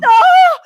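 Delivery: high, very loud cry; panicked cry. Acted. Maisie spotting hair on her arms, panicked cry. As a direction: cry out in alarm.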